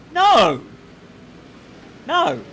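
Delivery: loud high shout of refusal. Real, not acted. Reacting to the comment.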